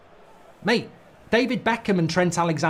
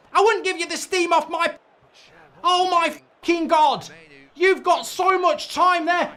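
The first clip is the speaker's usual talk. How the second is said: furious rant; 11 semitones higher than usual. Real, not acted. Fan screaming at the midfielder on screen, furious and high. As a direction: scream abuse at the wasted chance.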